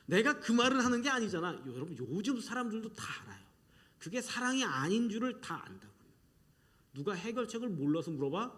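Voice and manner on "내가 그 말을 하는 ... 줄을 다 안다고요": normal talking voice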